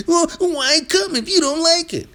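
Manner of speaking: high mincing mock of a whiny chatter; mocking girly voice. Streamer mocking a viewer's question in a girly voice. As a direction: squeak it out like a prissy complainer. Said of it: "that's such a girly logic path".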